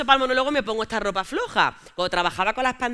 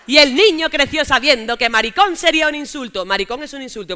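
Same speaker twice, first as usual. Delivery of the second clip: ordinary talk, nothing marked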